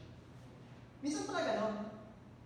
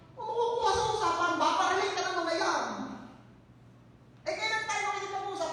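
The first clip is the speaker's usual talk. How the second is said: normal talking voice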